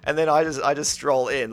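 ordinary delivery

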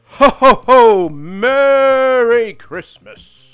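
loud, high ho ho ho, very slow Merry Christmas; big jolly Santa, held right to the end; booming Santa voice. Shown as a demonstration. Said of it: "Hey, there we go".

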